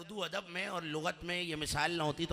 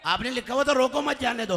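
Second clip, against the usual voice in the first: loud, raised-pitch protest; acted protest; 7 semitones higher, 9 dB louder than usual. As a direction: read the note back loudly in protest. Subordinate protesting to the officer. (ज़ोर से सफ़ाई देते हुए)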